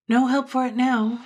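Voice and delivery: loud, high-pitched dandy exclamation; resigned dandy. Acted. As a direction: announce defeat with theatrical exasperation. Dandy exclaims to his hostess.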